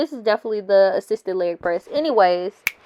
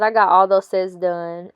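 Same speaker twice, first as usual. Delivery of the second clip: ordinary delivery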